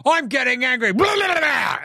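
mock a hothead boiling over into a shout; hammed-up meathead losing his temper; angry Draymond. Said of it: "He's like".

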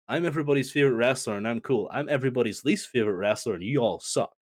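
fast, dismissive mock of Punk; rattled-off mock quote. Fan mocking Punk's narrative, rattled off quick and dismissive. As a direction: rattle it off like a sneer. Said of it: "he wants this narrative of".

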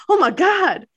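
high, loud, fast accusation; hammed-up exclamation of alarm; mock shocked outcry. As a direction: play up the horrified shock. Acts out Beck's feigned shock.

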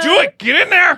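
high, loud mock shout. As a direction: yell the order playfully. Mock bullying into podcast.

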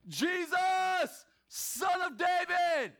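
high, drawn-out desperate cry; full-voiced acted shout, pitched high; yelling out. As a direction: call out slowly like a blind beggar seeking attention. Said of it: "He's yelling".

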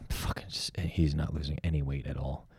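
unvoiced whispered jab, half to himself. Real, not acted. Teasing his absent friend in a whisper. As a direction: whisper it so he can't hear.

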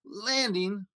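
ordinary delivery

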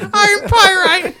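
laughing. Real, not acted. Laughing at his own joke.

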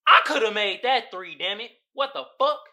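frustrated basketball fan venting; voicing angry man. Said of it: "He's looking like".

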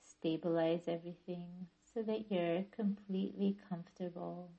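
calm yoga nidra guidance with long pauses; guided meditation. Acted. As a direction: ease listeners into stillness, phrase by unhurried phrase. Leads guided relaxation practice.

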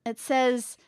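ordinary talk, nothing marked

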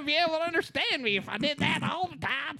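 high exaggerated Texas twang; hammed-up Texas accent; Texan drawl. Shown as a demonstration. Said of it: "switch to this voice".

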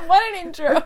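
loud high burst of laughter; laughing. Real, not acted. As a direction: crack up at the intro. Cohost cracks up at intro.

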